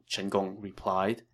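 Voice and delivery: usual speaking voice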